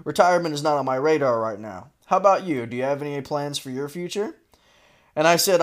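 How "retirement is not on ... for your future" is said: lightly voiced president, lower and quicker; a hint of a presidential tone; quoting the AI president. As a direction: read out the bot's reply in a lower voice. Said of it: "He said".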